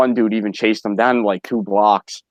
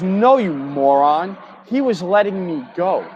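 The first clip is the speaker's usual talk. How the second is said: higher, slow mock of the scolding wife; exasperated wife; 8 semitones higher, slower than usual. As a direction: retell her snapping at him. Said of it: "She goes".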